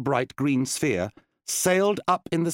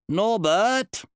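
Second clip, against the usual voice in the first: higher, rising call to the ogre; wizard Randolph; 10 semitones higher, 4 dB louder than usual. Acted. Wizard calling his ogre assistant, lightly voiced. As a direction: summon your assistant grandly.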